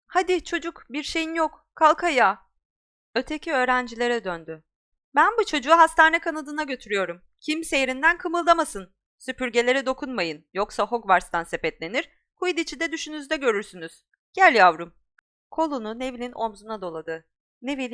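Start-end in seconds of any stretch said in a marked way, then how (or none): 0.1-2.4 urging teacher. Acted. Teacher urging injured boy up. 5.1-14.9 louder, higher stern orders; teacher threatening the pupils; stern teacher warning. Acted. Teacher warns the class.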